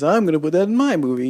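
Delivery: a hint of a pleased filmmaker grabbing an idea; copycat director. Reviewer mocking a copycat director, lightly voiced quick mock. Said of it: "and he says".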